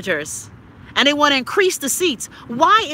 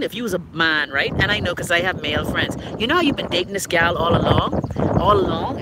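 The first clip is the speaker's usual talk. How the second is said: usual speaking voice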